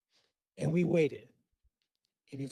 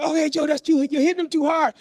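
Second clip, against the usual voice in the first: high, urgent protest; alarmed mother; 8 semitones higher, 4 dB louder than usual. Retold or imitated. Mother to father hitting the boys, alarmed protest. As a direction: imitate a mom intervening.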